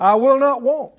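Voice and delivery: high, loud, defiant-sounding proclamation; out-loud declaration. Real, not acted. Preacher leads congregation in confessing faith, out-loud declaration. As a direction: declare it forcefully for the crowd. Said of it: "everybody say it out loud".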